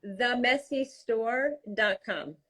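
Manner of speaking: slow, deliberate announcement of the web address. Shown as a demonstration. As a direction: spell it out slowly, word by word. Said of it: "Say it slower".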